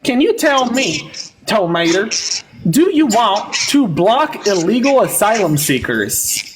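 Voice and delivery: put-on over-the-top Southern accent. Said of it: "I'm not that bad with the Southern accent".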